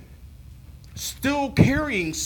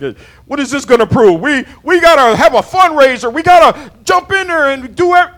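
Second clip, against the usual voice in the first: higher, louder complaining shout; childish protest shout; 6 semitones higher, 10 dB louder than usual. Retold or imitated. Preacher acting his childish complaint about yard work, loud whining shout. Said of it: "I'm like a child".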